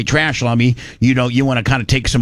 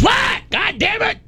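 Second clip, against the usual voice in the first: a sudden high yell of "What?", then cursing; yelled back; slower than usual. Retold or imitated. Doug yelling back at mom from the garage, a high angry shout. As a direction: bark it back like you've been interrupted.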